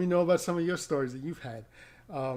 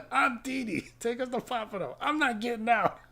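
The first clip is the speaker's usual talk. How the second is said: much higher, mock indignant drunk voice; higher mock drunk insistence; stubborn drunk lady; 8 semitones higher than usual. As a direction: ham up a tipsy woman refusing to budge. Drunk passenger refuses to leave.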